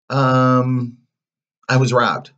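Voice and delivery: loud, high hesitant fib; stall, then blurt the lie loudly; boy making up an excuse; lying child. A narrator's reading. Pinocchio lies to the fairy.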